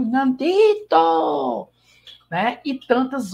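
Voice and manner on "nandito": high, long-drawn wail on "Nandito"; melodramatic, very slow cry of the name; telenovela intonation. Shown as a demonstration. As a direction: stretch "Nandito" out high, like a soap-opera diva. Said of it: "Com a entonação".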